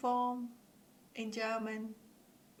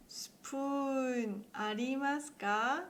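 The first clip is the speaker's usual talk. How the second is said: slow demo; 3 semitones higher, slower than usual. Shown as a demonstration. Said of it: "please say it slowly in English".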